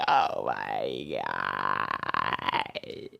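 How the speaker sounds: mock terror, stretched and deep; drawn-out oh my god. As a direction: drag out the panic, low and loud. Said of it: "I'm like".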